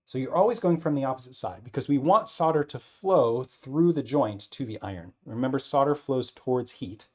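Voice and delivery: ordinary talk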